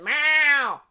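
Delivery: imitating the cat meowing loudly; loud imitated meow. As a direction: belt out a cat's meow. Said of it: "she's going".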